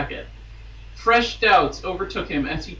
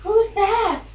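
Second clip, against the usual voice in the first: a much higher, small mock-timid voice; timid princess; 14 semitones higher than usual. Reading the romance aloud, voicing the timid princess. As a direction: squeak it out like a shy princess. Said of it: "whispered timidly".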